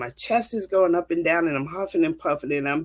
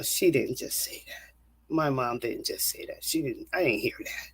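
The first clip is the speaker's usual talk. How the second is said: stunned whisper; 3 semitones lower, 7 dB quieter than usual. Retold or imitated. Disbelief after terrible news.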